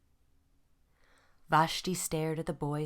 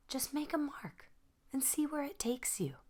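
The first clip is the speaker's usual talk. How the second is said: higher, softer, gentle encouragement; smiling teacher; 10 semitones higher, 4 dB quieter than usual. Acted. Teacher gently encouraging her stuck pupil, higher and softer. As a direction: coax her softly with a smile. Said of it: "Her teacher smiled".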